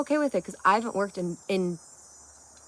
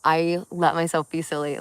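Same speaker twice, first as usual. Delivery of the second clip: usual speaking voice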